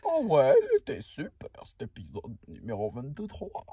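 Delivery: départ fort, puis voix grave et retenue; spectateur imité. Acted. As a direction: imite un fan, en retombant dans le grave. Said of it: "ou soit vous dites". (imitation de fan qui descend dans le grave)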